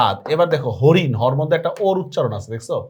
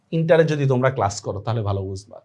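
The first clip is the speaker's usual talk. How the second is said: ordinary talk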